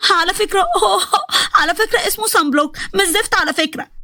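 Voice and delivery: high laugh-cry correcting him; laughing through tears. Acted. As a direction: giggle helplessly while hanging upside down. Hanging upside down, laughing-crying.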